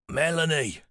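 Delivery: put-on old pilot recognizing her, barely; frail old man. A narrator's reading.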